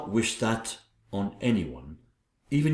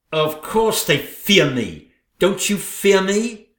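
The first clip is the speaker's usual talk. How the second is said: menacing queen; 7 semitones higher, 6 dB louder, slower than usual. Acted. Queen menacing her rival.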